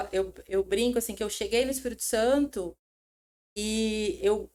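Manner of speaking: ordinary delivery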